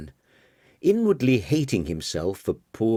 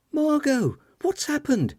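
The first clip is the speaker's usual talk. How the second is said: much higher, worried woman voice; alarmed woman. Acted. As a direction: call out in alarm. Alarmed guest calling to Margot.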